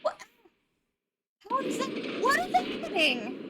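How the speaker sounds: high-pitched, loud disbelief; baffled alarm. Real, not acted. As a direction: squawk it in utter bewilderment. Startled by fire in the game.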